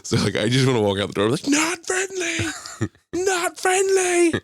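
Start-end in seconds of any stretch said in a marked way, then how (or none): 1.5-4.3 very high, stretched-out call; imitated video warning. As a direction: mimic the viral clip, pitched way up. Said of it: "the sound in the background's like".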